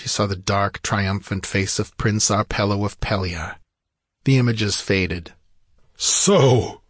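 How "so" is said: outraged warrior's outburst, a little raised; raving. A narrator's reading. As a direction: burst out in indignation. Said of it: "raved Conan".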